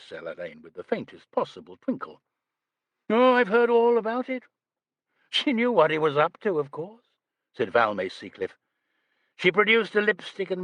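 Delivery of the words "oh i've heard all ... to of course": airy actress. A narrator's reading. Seacliff's airy voice.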